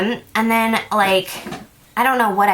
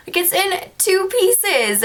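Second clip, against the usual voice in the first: high, slow, exasperated protest; whiny complaint; 10 semitones higher, slower than usual. Retold or imitated. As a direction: draw it out high, as if showing the damage. Indignant complaint over the broken ring.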